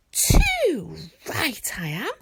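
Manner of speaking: loud, slow, grumpy dragon retort; grumpily. Acted. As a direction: huff it out, offended. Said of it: "said Toothless grumpily".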